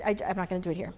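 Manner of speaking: ordinary talk, nothing marked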